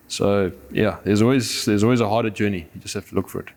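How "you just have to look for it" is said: normal talking voice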